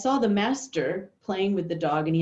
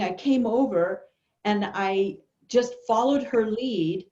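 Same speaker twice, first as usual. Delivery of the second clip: ordinary talk, nothing marked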